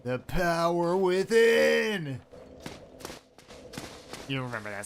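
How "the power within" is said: slow, high, loud and grand; booming slowed-down cartoon proclamation; dramatic SpongeBob reenactment. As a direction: stretch it out like a cartoon hero's big moment. Dramatic SpongeBob quote callback.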